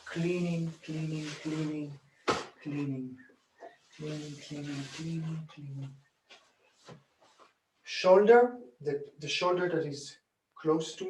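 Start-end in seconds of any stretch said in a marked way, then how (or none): 0.1-5.9 cleaning, cleaning murmured much softer, in steady rhythm; a quiet, even chant keeping time with the hands; soft rhythmic count. Shown as a demonstration. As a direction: count the strokes softly under your breath. Rhythmic count while massaging.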